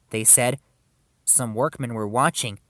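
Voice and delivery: ordinary delivery, nothing marked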